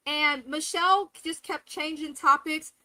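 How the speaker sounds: ordinary delivery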